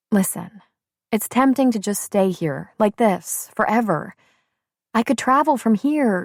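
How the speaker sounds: put-on brisk, lively young woman. A narrator's reading. Shelby speaks.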